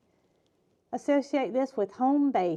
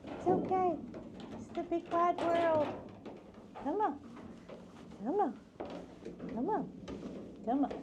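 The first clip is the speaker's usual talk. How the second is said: tender coaxing; 7 semitones higher than usual. Real, not acted. Rehabber coaxing her fawns out into the wild, high and tender. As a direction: coax the babies out gently.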